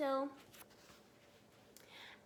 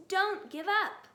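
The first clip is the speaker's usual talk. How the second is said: high, louder breathless plea; panting urging; 6 semitones higher, 7 dB louder than usual. A narrator's reading. Mister Fox urging his children on. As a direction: keep them running. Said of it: "panted Mister Fox".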